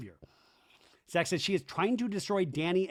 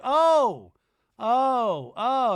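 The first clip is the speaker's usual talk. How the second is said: much higher, louder offended oh-oh-oh; mock outraged gasps; 8 semitones higher, 10 dB louder, much slower than usual. [name] reacting to Dodie, mock offended gasps. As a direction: gasp like you've been insulted.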